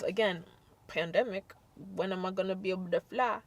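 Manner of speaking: ordinary talk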